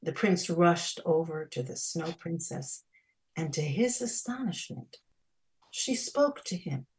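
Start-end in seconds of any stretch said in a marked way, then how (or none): none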